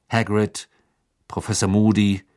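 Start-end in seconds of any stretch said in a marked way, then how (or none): none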